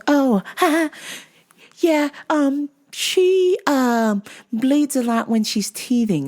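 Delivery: high, halting mock excuse with nervous laugh; airy mother imitation. Mother explaining the nosebleed to store worker, airy and halting. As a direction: imitate a clueless mom in a high voice.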